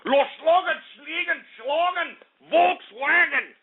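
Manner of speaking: high, fake German gibberish; mock German accent. Radio host introducing the German market in mock German gibberish.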